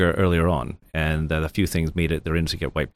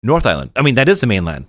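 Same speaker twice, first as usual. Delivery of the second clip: indignant insistence; 5 semitones higher, 6 dB louder than usual. Retold or imitated. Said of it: "So usually they say".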